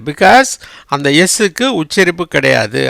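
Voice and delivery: normal talking voice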